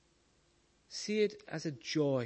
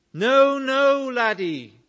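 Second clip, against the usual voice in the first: put-on old lady's protest; 8 semitones higher, 10 dB louder, slower than usual. Old lady objects.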